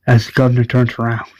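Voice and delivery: usual speaking voice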